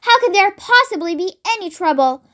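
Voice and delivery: ordinary delivery, nothing marked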